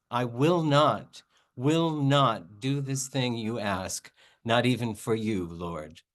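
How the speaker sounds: normal talking voice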